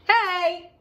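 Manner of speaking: roar. Acted. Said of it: "the scariest monster roared".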